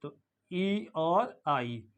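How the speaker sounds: ई और आई said very slowly; slow symbol demo. Shown as a demonstration. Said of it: "इसका symbol क्या है?".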